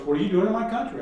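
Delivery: mildly acted hostile question; unhappy challenge. Unhappy local confronting John on the bus, mild hostile demand. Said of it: "He is not a happy man".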